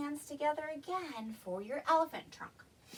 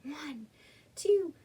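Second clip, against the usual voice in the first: ordinary delivery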